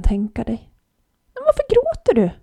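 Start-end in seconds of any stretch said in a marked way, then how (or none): 1.3-2.3 very high, squeaky little animal; a tiny shrew peeping up at a girl. A narrator's reading. Shrew asks the crying girl why, very high.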